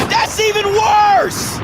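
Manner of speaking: incredulous outrage, slowed right down; outraged. Real, not acted. Outraged repetition.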